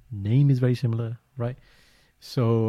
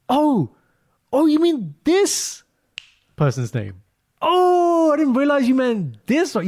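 high, loud, fake-surprised oh; recovery mode; 5 dB louder, slower than usual. Doctor bluffs to a colleague about a forgotten name, fake surprise. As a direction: act as if you knew the name all along. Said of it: "recovery mode".

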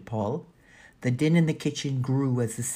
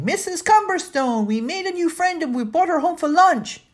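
put-on high, loud child's yell; excited yelling; 8 dB louder than usual. A narrator's reading. Danny calls to the housekeeper on coming in the door, yelling. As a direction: yell the news through the house at the top of your voice. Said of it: "Danny was yelling".

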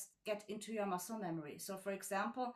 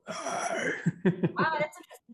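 laughing; 5 semitones higher, 10 dB louder, slower than usual. Real, not acted. Laughs at interviewer's joke.

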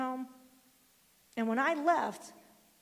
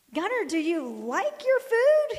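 put-on high, louder, slow teasing kid; excited kid; 8 semitones higher, 10 dB louder, slower than usual. Grandson taunting brother, excited. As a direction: tease like a thrilled little boy.

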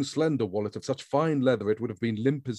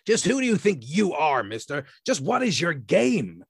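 much higher, indignant tough guy; indignant skepticism; 6 semitones higher, 4 dB louder than usual. A narrator's reading. The man doubting Grand, high and indignant. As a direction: demand it with suspicion. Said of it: "shrewd skepticism, heavily flavored with indignation".

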